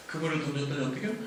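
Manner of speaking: ordinary talk, nothing marked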